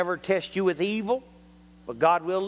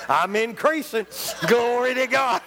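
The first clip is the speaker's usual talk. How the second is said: joyful praise through laughter, pitched up; laughing praise; 6 semitones higher than usual. Real, not acted. Preacher rejoicing to the congregation over restaurant news, laughing. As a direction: laugh as you give glory.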